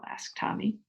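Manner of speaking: ordinary delivery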